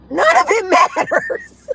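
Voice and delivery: high, laughing outburst; laughing dismissal. Real, not acted. Streamer mocks everybody's worries, laughing it off. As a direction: burst out laughing through the words.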